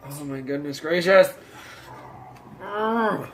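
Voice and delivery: loud, high shocked cry; shocked exclamation. Real, not acted. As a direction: gasp it out in disgust. Seeing bad teeth, exclaiming.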